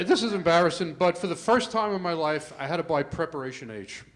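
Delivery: normal talking voice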